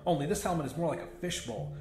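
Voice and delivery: plain reading voice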